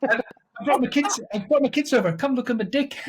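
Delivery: high-pitched mock South African; South African accent. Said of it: "it's a South African advert".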